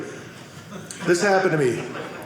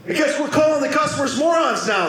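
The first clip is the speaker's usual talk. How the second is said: raised-pitch mock reprimand; imitating scolding supervisor; 8 semitones higher than usual. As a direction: scold sarcastically like an exasperated boss. Retold supervisor scolds him.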